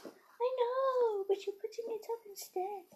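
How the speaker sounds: high and soft, answering back; the dog talking back, squeaky; dog's voice. Pretend. Girl voicing her dog's sassy excuse, high and soft.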